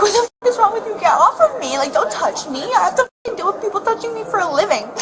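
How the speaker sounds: high, heated retold yelling; indignant retold shouting, high at the start; retold angry outburst. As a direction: replay the fury of being grabbed. Retold fight with attendant.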